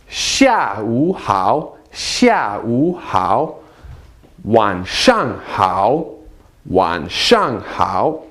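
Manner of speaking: each greeting drawn out slowly, tones carefully shaped; teacher modelling tones; slow Mandarin model greetings. Shown as a demonstration. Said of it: "let's repeat these greetings".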